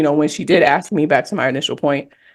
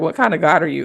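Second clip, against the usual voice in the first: ordinary talk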